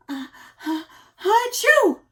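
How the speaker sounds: loud, high building sneeze; acted sneeze. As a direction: wind up and sneeze big. Amos sneezes in story.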